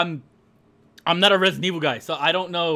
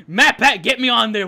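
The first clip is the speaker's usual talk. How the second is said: louder call to the hosts; 3 semitones higher, 8 dB louder than usual. Real, not acted. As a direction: shout for Matt and Pat. Calling out to Game Theory hosts.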